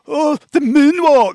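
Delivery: high, drawn-out mocking echo; slow, high-pitched mimicry; echoing a player's words. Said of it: "he echoes what you said about".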